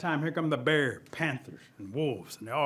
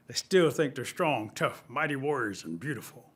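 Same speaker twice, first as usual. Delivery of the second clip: ordinary delivery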